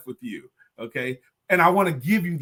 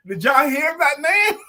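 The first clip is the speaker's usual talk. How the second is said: host shouting to the audience in delight; excited call out; 9 semitones higher, slower than usual. Real, not acted. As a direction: call out to everyone, thrilled.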